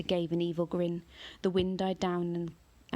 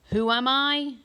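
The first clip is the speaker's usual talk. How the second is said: a much higher, much louder roar; 7 semitones higher, 9 dB louder, slower than usual. A narrator's reading. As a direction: bellow it to the sky. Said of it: "still roaring".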